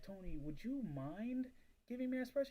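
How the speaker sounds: a nervous newbie timidly asking a big seller for help. Shown as a demonstration. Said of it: "in this voice".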